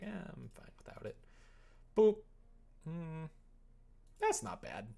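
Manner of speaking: ordinary delivery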